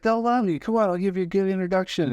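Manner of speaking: high, fast pep-talk voice; eager urging. Retold or imitated. Friend Gary hyping the comic to take the gig, eager and fast. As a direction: hype him up quickly.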